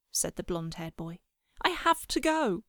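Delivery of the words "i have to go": put-on high-pitched, insistent child's plea; pleading. A narrator's reading. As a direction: plead with Mum, voice raised in pitch. Boy pleads with mother about bullies.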